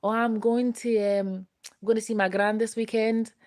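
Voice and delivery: high-pitched put-on Scottish accent. Friends announcing a weekend visit to gran, Scottish accent. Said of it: "I'm gonna do my Scottish accent for you".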